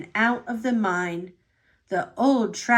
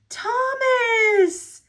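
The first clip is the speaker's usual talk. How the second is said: happy call; 12 semitones higher than usual. Acted. Rescuer engine calls happily into the dark mine. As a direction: call out the name with joy. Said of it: "he whistled happily".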